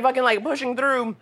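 usual speaking voice

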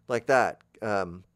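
normal talking voice

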